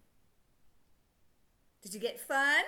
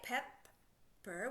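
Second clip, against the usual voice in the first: slow, two separate parts, PEP then PER; drawn-out Pepper; sounding it out; 4 semitones lower, 6 dB quieter than usual. Shown as a demonstration. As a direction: blend it slowly, syllable by syllable. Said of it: "What's it make?".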